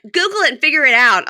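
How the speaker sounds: imitating an impatient girlfriend; exasperated retort. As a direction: push it out loudly, out of patience. Acting out her fed-up reply.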